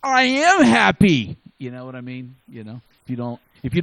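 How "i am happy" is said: loud, slow, grumbling "I am happy"; defensive grump barking that he's happy; grumpy insistence. Pretend. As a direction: snap it back like a sourpuss who won't admit it. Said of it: "you need to be happy".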